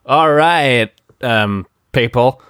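rough American-accent presidential impression; off-the-cuff spoof of an American president's accent; Trump. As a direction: put on a mock presidential American accent, slowing to start. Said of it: "do it in a Trump accent".

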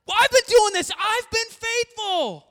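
loud, high, wounded pride, slowing on faithful; indignant Peter. Retold or imitated. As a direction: Peter defending his own loyalty, loud. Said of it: "'cause he's like".

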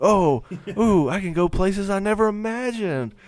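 high, giddy, gushing voice; 1950s housewife. Pretend. Voicing the healed man, excited.

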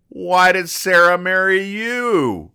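a gleeful, drawn-out gotcha question; Eula's punchline. Retold or imitated. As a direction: stretch it out high and slow to land the zinger. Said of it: "And then Eula goes".

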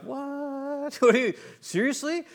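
high, disbelieving with a laugh; incredulous surprise. Retold or imitated. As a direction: react as if he can't believe it. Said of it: "And I was kinda like".

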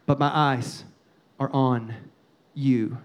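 low, measured, with pauses between phrases; hushed trust. Retold or imitated. Believer praying when lost for answers, quietly trusting. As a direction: let it sink low and deliberate.

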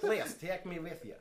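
laughing through a mock Eastern European plea; mock Eastern European accent. Imitating Eastern European suitors to co-host, laughing. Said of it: "Eastern European people have ever said to me".

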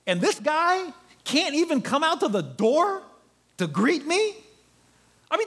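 huffy Naaman, pitched high; offended general. Acted. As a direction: play the insulted commander.

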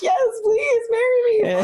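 very high, tearful mock cry; overwhelmed acceptance. Woman reenacting her answer to his proposal, high and overcome. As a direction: squeal it out as if breaking into happy sobs. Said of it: "I was very upset".

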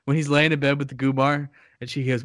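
ordinary talk

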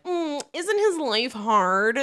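drawn-out, high mock sympathy; mock pity. Podcaster parodying a film begging sympathy for the prince, mock pity. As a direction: coo it slowly, with exaggerated pity.

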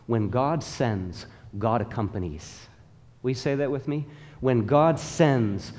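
ordinary talk

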